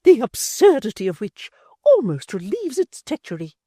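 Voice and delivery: high, loud, cutting sarcasm; lofty sarcastic sneer; sarcastic dignity. A narrator's reading. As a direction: scorn dressed up as dignity. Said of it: "with sarcastic dignity".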